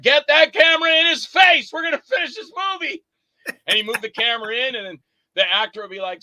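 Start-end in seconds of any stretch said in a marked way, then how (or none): 0.0-3.0 higher, louder barked order; commanding shout. Retold or imitated. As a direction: take over the set, loud.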